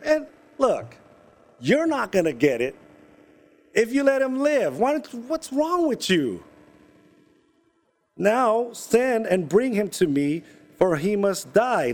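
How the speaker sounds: high exasperated rant, slowing into a death order; angry king. Retold or imitated. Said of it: "So he's saying".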